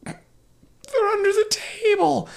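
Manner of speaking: very high, drawn-out mock wail; truthful little sister. Sister confessing to the Gestapo, high wailing voice. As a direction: squeal it out slowly like a little girl.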